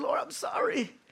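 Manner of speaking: high, soft mock plea; imitated crying out. Jonah cries to the Lord from the fish, imitated pleading. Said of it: "he cried to the Lord".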